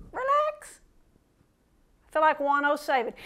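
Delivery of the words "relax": 1 soothing coax. Acted. Preacher coaxing congregation.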